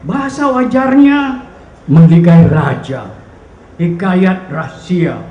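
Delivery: impassioned poetry reading, swelling and falling; poetry recitation. Acted. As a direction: declaim the poem in measured phrases with dramatic pauses. Recites a poem aloud. (deklamasi puisi yang dramatik)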